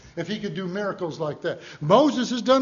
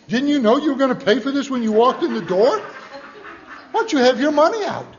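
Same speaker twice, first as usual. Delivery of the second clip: louder, higher, huffy complaint; mock exasperated rant; 7 semitones higher, 8 dB louder than usual. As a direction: scold her for holding up the line. Impatient rant at the woman.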